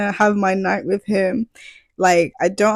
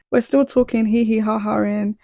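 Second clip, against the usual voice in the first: ordinary talk, nothing marked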